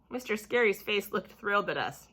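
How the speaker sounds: ordinary delivery, nothing marked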